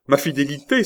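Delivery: tearful cry. A narrator's reading. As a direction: cry out in hurt protest, tears only suggested. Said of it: "s'écria Rosa tout en larmes". (exclamation blessée, éplorée)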